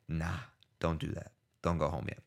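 low, hushed word to yourself; quiet, low inner voice; retold self-talk. Talking himself out of going home.

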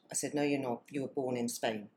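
ordinary talk

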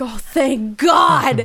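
slow, drawn-out relieved exclamation; acted relief. Teen saved from the date by mom, acted relief. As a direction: stretch it out in mock deliverance. Relieved inner reaction to rescue.

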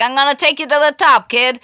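put-on high, loud, fast New York accent; brassy New York talent agent promising the world. Old New York agent pitching a struggling actor, loud fast New York accent. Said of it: "sound like a sort of a woman called Estelle".

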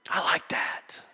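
sudden hushed, voiceless aside; whispered aside. Real, not acted. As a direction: whisper it under your breath. Hushed aside after forceful preaching.

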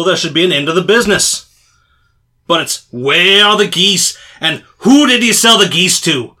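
loud, fed-up trader ranting; exasperated rant. Acted. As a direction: vent about being pestered. Salesman ranting about pestering.

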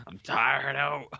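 slow, laughing and sleepy. Real, not acted. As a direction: drag it out through a laugh. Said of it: "It's Nick Nolte over here".

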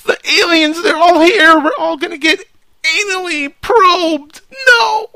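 very high, loud mock wail; hammed-up terror, dragging out the last words; trembling panicked caller. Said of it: "when he's trembling".

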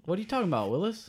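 high-pitched mock catchphrase; sitcom catchphrase. Co-host teasing with a catchphrase, high mock voice. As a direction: squeak it as a cheeky catchphrase.

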